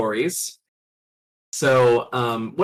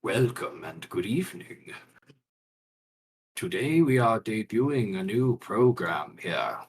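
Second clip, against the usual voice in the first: low, slow dramatic radio host; radio announcer; 3 semitones lower, slower than usual. Acted. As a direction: intone it like a spooky radio show. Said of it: "over the radio".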